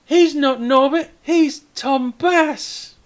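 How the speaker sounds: put-on high, loud kid piping up; proud kid correction, stretched on the name; child's voice. Kid points up at the hero to correct the mayor, high child's voice. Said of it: "one of the kids points up at him and says".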